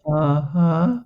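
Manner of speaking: humouring uh-huh. Retold or imitated. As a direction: play along doubtfully, voice lifting. Mock-agreeing to young therapist in story.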